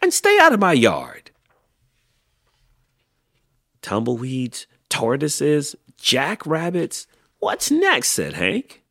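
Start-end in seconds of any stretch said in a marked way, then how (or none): 0.0-1.3 high, very loud angry order; yelled back. A narrator's reading. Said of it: "Hank yelled back". 3.8-8.1 rising exasperation, climbing to a high pitch; exasperated. A narrator's reading. Grumpy Hank lists his intruders.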